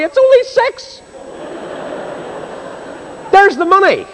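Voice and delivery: very high, indignant outburst; protesting cry. Retold or imitated. Billy corrects the auctioneer over his money, protesting cry. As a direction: insist in a high, emphatic cry. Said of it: "cried Billy".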